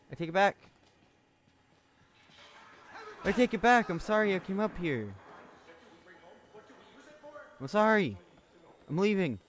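loud, high panicked pleading; panicked apology. Real, not acted. Player fleeing an attacker, loud high panicked pleading. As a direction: beg your way out in a panic.

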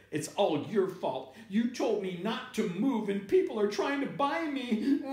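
put-on high, wailing little-kid complaint; acted child's tearful protest; upset child. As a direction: whine it on the edge of tears. Upset child blames father, then wails.

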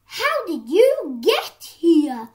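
loud, high, slow astonished question; storybook horse, amazed; surprised. A narrator's reading. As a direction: gasp out the question in disbelief.

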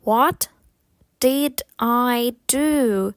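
slow, drawn-out words with gaps. Shown as a demonstration. Teacher repeats the question for learners, slowly. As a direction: say it word by word, stretching each one. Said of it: "Повільніше".

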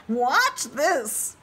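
high, slow lamb voice. Acted. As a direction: bleat it out gently. Lamb speaks.

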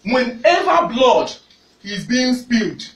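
fiery sermon, rising to shouts; preaching. Acted. Preacher exhorting congregation.

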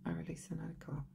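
measured monotone affirmations with long pauses; guided tapping recitation. Shown as a demonstration. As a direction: recite each phrase evenly and quietly, leaving room to repeat.

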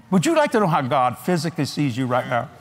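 ordinary talk

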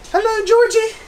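high, loud mock Pennywise greeting; sing-song it like the clown from It; creepy clown voice. Showing the It-themed cake, a high, loud clown voice.

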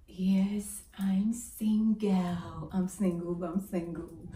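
breathy whispered answer; whispering. Real, not acted. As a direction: whisper it, drawn out slowly.

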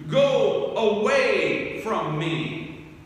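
slow emphatic quote. Acted. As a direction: let Peter's plea hang heavy. Repeating Peter's words, drawn out.

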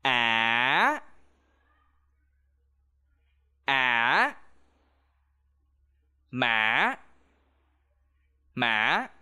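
á and má dip low, then rise; low rising tone. Shown as a demonstration. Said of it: "Repeat after me".

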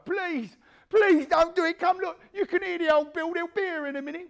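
high, loud desperate pleading; begging for his life. Retold or imitated. Man on the floor begging not to be cut, high frantic pleading. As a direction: beg like your life depends on it. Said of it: "he wants to beg for his life".

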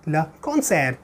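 le concert with silent t, soft and low; French pronunciation. Shown as a demonstration. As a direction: concert said kon-SAIR, quietly. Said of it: "T is silent".

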